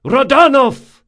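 high, loud yell across the deck. A narrator's reading. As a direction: bellow the name at the enemy captain. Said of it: "yelled Drakasha".

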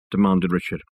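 usual speaking voice

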